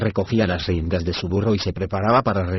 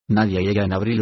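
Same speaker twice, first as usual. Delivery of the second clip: ordinary delivery, nothing marked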